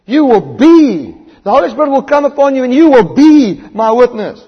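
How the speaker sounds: loud, ringing pulpit delivery; rising sermon fervour; full-force preaching. Acted. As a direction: preach it out to the whole hall.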